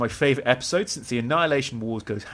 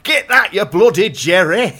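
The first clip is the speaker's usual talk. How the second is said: loud, high mock Yorkshire soldier shout; slow, hammed-up Yorkshire accent yell; 6 semitones higher, 9 dB louder than usual. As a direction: bark it like a Yorkshire commando in a game. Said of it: "They've all got Yorkshire accents".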